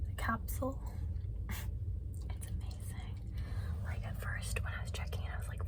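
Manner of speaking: soft unvoiced whisper. Real, not acted. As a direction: whisper so nobody hears. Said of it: "I have to whisper 'cause we can't talk in here".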